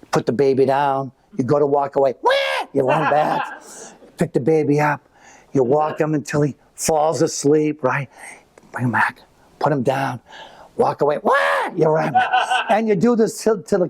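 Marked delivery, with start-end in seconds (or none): 2.2-2.3 mock baby wail "wah"; a quick imitated infant cry. Parent sets baby down, baby wails "wah". 11.2-11.3 mock baby wail "wah"; a quick imitated infant cry. Parent sets baby down, baby wails "wah".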